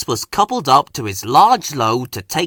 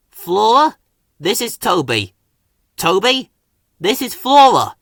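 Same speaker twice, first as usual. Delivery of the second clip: higher, louder nervous brave voice; brave introduction; 8 semitones higher, 6 dB louder than usual. A narrator's reading. Thomas introducing Flora to Toby, bravely. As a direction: steel yourself. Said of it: "Thomas puffed bravely".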